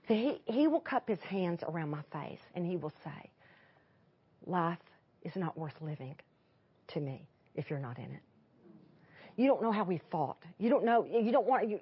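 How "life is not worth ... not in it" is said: low, soft, halting sincerity; hushed, heartfelt, retold love words; tender declaration. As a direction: say it gently, letting it sink to near nothing.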